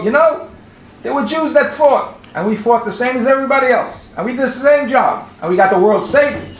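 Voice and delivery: high-pitched, insistent retort; emphatic quoted protest. Retold or imitated. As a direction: read the quote as an animated, proud rebuttal.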